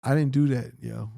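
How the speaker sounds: ordinary talk, nothing marked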